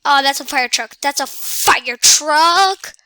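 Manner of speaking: loud, startled yelling that builds; loud outburst of excitement; excited yelling. Real, not acted. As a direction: yell it out, stretching the last words. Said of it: "Sorry if I'm yelling".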